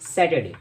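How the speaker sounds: "सैटरडे" as sat-uh-day; correct pronunciation. Shown as a demonstration. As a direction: repeat "सैटरडे" without the r. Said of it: "इसमें आर silent होता है".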